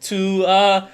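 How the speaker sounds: high, loud, flustered compliance; frightened gnome. Acted. Intimidated gnome owner giving in to collectors, high and flustered. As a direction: cave in with a panicky high voice. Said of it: "visibly starts shaking".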